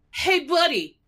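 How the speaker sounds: ordinary talk, nothing marked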